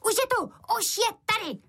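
put-on high, squealed child's alarm; shouting boy. A narrator's reading. Boy slams the door and cries out, high shout. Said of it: "potom je rychle zabouchl a křičel".

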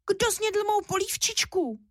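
high, thin little bear-cub voice; unhappy thin squeak. A narrator's reading. Little bear Míša finds his soup eaten, squeaking unhappily. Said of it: "Vypískl nešťastně tenkým hláskem".

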